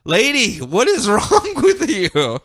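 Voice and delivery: high, drawn-out comic yelp of protest; hammed-up disbelief with a laugh breaking in; mock exasperation. Superman to Huntress charging with another knife, mock exasperation.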